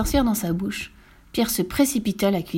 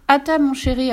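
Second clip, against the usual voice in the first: calling out to the table, higher and louder; raised, sing-out mother's call; 6 semitones higher, 5 dB louder than usual. Acted. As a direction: call a child to dinner. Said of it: "appela sa maman".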